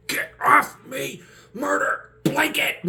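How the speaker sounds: high, loud, slow yelps; mock panicked yells. Retold fight lines, panicked high yells. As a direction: yell each word in a panic.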